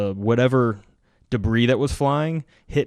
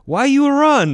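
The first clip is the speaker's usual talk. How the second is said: put-on much higher, louder foreign-accented voice; high, loud mock question in accent; mimicking Ukrainian guy; 7 dB louder, slower than usual. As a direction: squeak it out like a puzzled foreigner. Ukrainian counterpart quoted.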